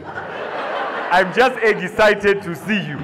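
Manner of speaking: slow, leering come-on; sleazy creep. Acted. Queue guy leers at Beckham, slow and suggestive. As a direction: drag it out like a creep.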